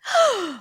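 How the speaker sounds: high mock gasp of shock; dramatic gasp. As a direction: gasp theatrically at the reveal. Gasp at reveal.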